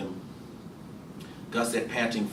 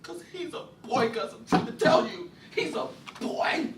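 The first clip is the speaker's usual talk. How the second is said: nervous laugh; 4 dB quieter than usual. Acted. Imogene half-laughing, desperate.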